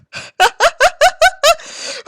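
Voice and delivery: high helpless cackle; burst of laughter. Real, not acted. Reader laughing at disc golf in the fic, high cackle. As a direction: crack up at the absurdity.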